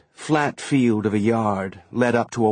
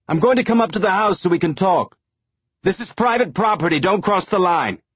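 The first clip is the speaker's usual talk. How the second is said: high, loud warning call; shouted; 9 semitones higher, 4 dB louder than usual. A narrator's reading. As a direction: yell a firm warning. Shouted exchange across yard.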